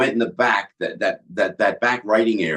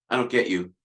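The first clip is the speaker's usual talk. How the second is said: flat cold O'Donoghue; 4 semitones lower, 6 dB quieter, faster than usual. Retold or imitated. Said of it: "He goes".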